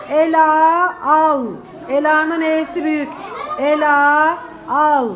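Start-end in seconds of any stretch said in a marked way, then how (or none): 0.1-1.5 put-on slow, loud dictation to children; dictating. Shown as a demonstration. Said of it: "Şimdi cümle yazacağız". 3.6-5.1 slow, careful dictation; dictating. Shown as a demonstration. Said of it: "Şimdi cümle yazacağız".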